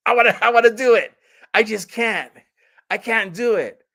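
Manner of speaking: hammed-up desperate whine; mock despair. Viewer telling Gilbert they can't go through with it, mock despairing. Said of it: "you're like".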